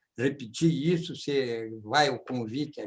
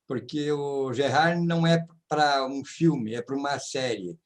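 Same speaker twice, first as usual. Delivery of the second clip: ordinary delivery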